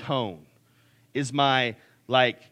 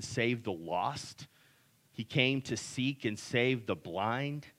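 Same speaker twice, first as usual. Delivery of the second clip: closing prayer; 4 semitones lower, 4 dB quieter than usual. Acted. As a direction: pray gently, each phrase unhurried. Pastor leads closing prayer.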